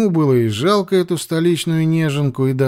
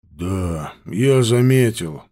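gloomy; 3 semitones lower, slower than usual. Acted. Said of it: "мрачно ответил Ауранг".

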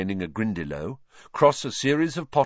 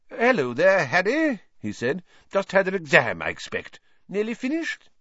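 higher, hearty minister voice; lightly voiced jovial official; Fudge's voice; 10 semitones higher than usual. A narrator's reading. Fudge greeting Harry.

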